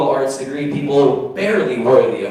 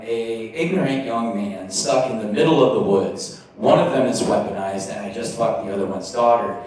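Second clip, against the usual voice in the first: ordinary talk, nothing marked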